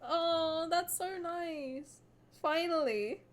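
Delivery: high, slow tearful sigh; real crying. Real, not acted. Streamer moved by Zane's happy ending, teary and slow. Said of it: "I'm actually crying".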